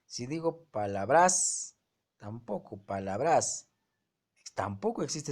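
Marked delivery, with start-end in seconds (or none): 0.6-1.8 "palabras" slowed with emphatic final BRAS; wrongly stressed pa-la-BRÁS, as a demo; stress on the last syllable. Shown as a demonstration. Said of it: "La mayor fuerza está en bras". 2.8-3.7 "palabras" with the weight on final BRAS; stress on the last syllable. Shown as a demonstration. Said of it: "La mayor fuerza está en bras".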